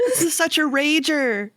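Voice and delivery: put-on high, hyped-up child voice; partying kid. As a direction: whoop it up as a thrilled kid. Acts kid enjoying grandpa's party.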